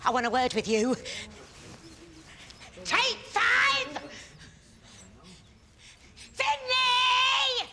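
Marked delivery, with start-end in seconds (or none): 2.8-4.0 very high-pitched yelled command; shouted break call. Acted. Director halts rehearsal after accident, high shouted call. 6.3-7.7 long high-pitched holler; yelled name. Acted. Director yells for Finley after rehearsal accident, long high holler.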